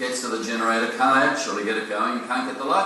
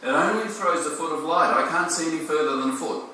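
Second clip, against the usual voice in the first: higher, lightly voiced small boy; a hint of a frightened seven-year-old; mild child voice, pitched up; young son; 6 semitones higher than usual. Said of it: "The son says".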